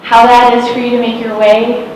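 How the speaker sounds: ordinary talk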